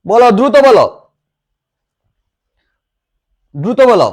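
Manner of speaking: loud teacher hurrying the class; urgent prompting. Real, not acted. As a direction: call out for a quick answer. Teacher urges class to answer quickly.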